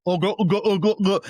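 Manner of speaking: loud, high chomping grunts; mock Pac-Man gobbling sounds; Pac-Man noise. To colleagues at work, a loud jokey Pac-Man chomp. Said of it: "And I started making the noise".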